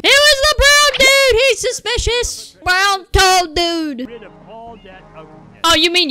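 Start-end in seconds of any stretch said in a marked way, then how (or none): 0.0-4.1 high, loud tattling voice; mock tattling. Gamer pretending to blame another character, high and loud. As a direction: point the finger like a whiny kid.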